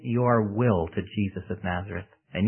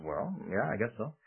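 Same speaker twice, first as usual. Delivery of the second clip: reluctant agreement; 2 semitones lower, 11 dB quieter than usual. Acted. Young woman answering the preacher about prayer, quiet reluctant agreement. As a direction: give in quietly, unsure.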